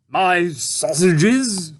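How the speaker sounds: a hungry tramp asking; puzzled tramp. A narrator's reading.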